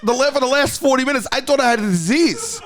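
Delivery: high, laughing disbelief at the prank; mock-indignant retelling; incredulous outrage. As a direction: pitch way up, amazed and half laughing.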